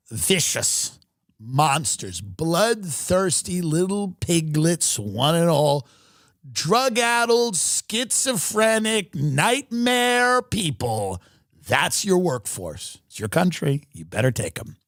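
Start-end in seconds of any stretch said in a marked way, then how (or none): 0.1-11.2 slow dragged insults. Real, not acted. Escalating insult list.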